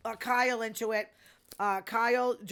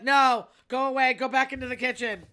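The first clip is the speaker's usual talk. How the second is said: louder, higher shoo-them-off call; mock loud shooing; 3 semitones higher, 9 dB louder than usual. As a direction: wave the waiters back to the kitchen. Retold shooing of waiters.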